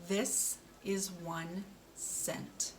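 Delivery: "This is one cent" said very slowly, each word apart; slow model sentence. Shown as a demonstration. Speech therapist models a sentence for a child, slow and careful. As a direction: spell it out slowly for the learner. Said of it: "Let's try that".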